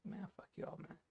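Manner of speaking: hushed, breathless aside to the class; whispered jab. Retold or imitated. Student mocking classmates out of teacher's earshot, whispered. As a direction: whisper it behind the teacher's back.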